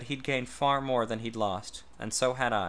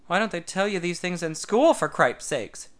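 amazed exclaim; 6 semitones higher, 5 dB louder than usual. A narrator's reading. Tad exclaims over mountain.

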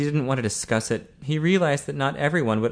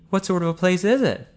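higher, curious question; interested; 8 semitones higher, 3 dB louder than usual. A narrator's reading. Ian asking Ted about his house, interested. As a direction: ask with lifted, lively interest. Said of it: "looked up from the road with interest".

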